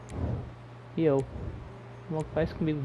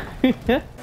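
frustrated laugh; 10 semitones higher than usual. Real, not acted. Laughs after the crash.

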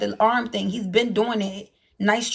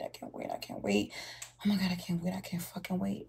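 low, soft muttering to self; soft exasperated mutter; 4 semitones lower, 13 dB quieter than usual. Real, not acted. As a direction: grumble it under the breath.

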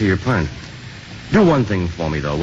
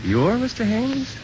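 surprised; 13 semitones higher, slower than usual. Acted. Priest surprised at the landlord.

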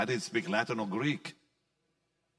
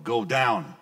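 louder, firm order; firm command; 7 dB louder than usual. Retold or imitated. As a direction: give a firm, forceful command. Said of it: "voice became firm".

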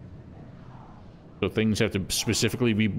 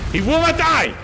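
full-throated furious yell, much higher and louder; frustrated yell; 8 dB louder than usual. Real, not acted. Gamer yelling at an unkillable enemy, furious shout. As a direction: roar it out in disbelief at the boss. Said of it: "Otis doesn't like it when I yell".

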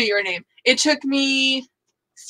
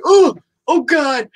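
drawn-out, higher, louder oh-God; mock horror at spotting a spider; retold alarm; 5 semitones higher, 5 dB louder, much slower than usual. Telling friend about spotting a spider, alarmed.

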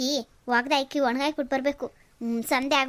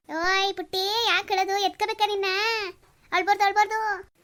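put-on coaxing a child; 6 semitones higher than usual. Visitor coaxing crying child.

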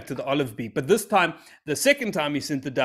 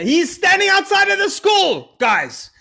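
very high, loud comic yell; mock shout; 9 dB louder, slower than usual. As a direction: belt it out, squeaky and shrill-free but way up. Shouted punchline of parents scene.

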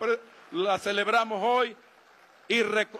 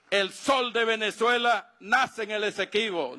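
rousing shouted rally cries; ranting dictator speech; 4 semitones higher than usual. Real, not acted. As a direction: shout each viva to rouse the crowd. Rally chants closing the speech.

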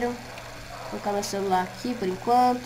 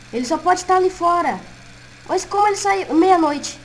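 higher, louder anxious voice; rising panic; panicked; 7 semitones higher, 6 dB louder than usual. Acted. Fox sumiu, pânico. (em pânico)

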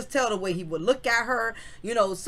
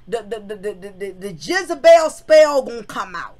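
ordinary talk

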